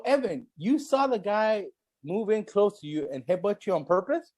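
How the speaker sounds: normal talking voice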